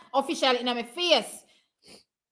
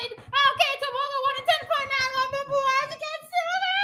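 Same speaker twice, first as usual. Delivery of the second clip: excited; 11 semitones higher, 4 dB quieter than usual. Real, not acted. Excited after race result.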